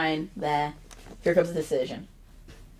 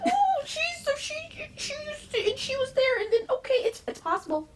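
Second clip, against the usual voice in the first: very high, squeaky mock-tearful gushing; high breaking excitement, re-enacted; overcome fan. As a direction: gush like an emotional fan, gradually settling. Re-enacts own tearful reaction to debut.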